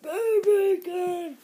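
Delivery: high, loud cartoon freak-out; crazed shrieking. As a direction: wail it in hysterical panic. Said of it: "acting a little too over crazy".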